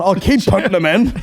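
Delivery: high, loud mock old man; old boy voice.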